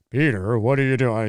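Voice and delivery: lightly deepened cartoon neighbour voice; tentative Joe Swanson. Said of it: "It's kinda deep or whatever".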